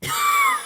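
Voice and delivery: very high squealed laugh; high laugh catching in the throat. Real, not acted. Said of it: "Why is my throat acting up?".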